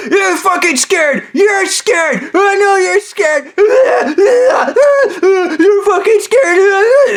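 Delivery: high, screechy mock screaming; hysterical taunting. Imitating Stu pulling him over, screaming taunts. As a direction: taunt in a drawn-out hysterical shriek.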